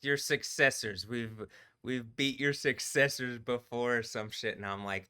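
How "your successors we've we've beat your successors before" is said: mock villain threat. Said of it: "is this the part where it's like".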